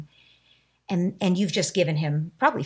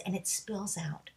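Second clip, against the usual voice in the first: hushed; 13 dB quieter, slower than usual. Real, not acted. Speaker drops to a hush.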